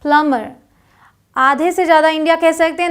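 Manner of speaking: ordinary talk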